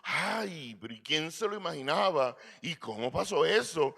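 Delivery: hammed-up scandalized astonishment. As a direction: imitate a shocked busybody, pitch lifted. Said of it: "de caer en escándalo de".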